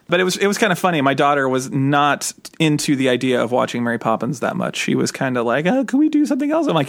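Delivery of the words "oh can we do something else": put-on high, fast whine of a reluctant kid; a put-upon little girl begging off; whiny kid voice. Dad imitating his bored daughter, high and whiny.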